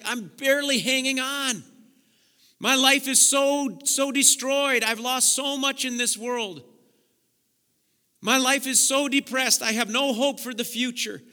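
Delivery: voicing a desperate person, loud and pleading. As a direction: cry out like someone barely holding on. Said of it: "they're just desperate".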